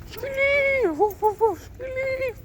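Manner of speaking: very high squeaky voice calling the dog. Acted. Calling the dogs in the street, very high squeaky barking call.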